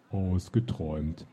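low, slow booming giant; giant's call. Acted. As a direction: let it roll out like a huge giant. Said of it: "rief ein Riese".

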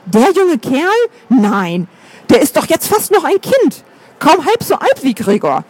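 mildly scandalized, pitch leaping up on the questions; shocked disbelief. A narrator's reading. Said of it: "schnappte erschrocken nach Luft".

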